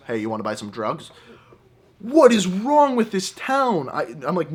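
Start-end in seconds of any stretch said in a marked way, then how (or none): 0.1-1.1 low, soft sales pitch; shady drug dealer. Acted. Street dealer to drunk tourist, shady low offer. 2.0-3.9 high, slow, incredulous question; exasperated disbelief. Acted. Tourist reacting to yet another shady offer, exasperated.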